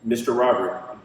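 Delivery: normal talking voice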